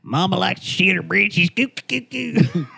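high-pitched, silly comic yokel voice; Larry the Cable Guy. Pretend. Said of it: "a fucking Larry the Cable Guy".

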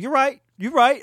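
loud, high mock surrender; beaten man conceding. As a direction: yelp agreement as if just punched. Acts out punched man conceding.

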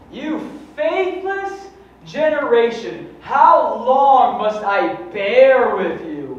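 high, loud, slow stern rebuke; Jesus rebuking. Acted. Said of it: "that sounds for real".

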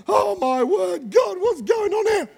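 imitating a frantic new parent's cry, high and breaking; panicked new parent prayer. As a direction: beg God for help like a frantic new parent. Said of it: "When you first have your baby".

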